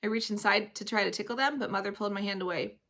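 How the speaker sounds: ordinary delivery, nothing marked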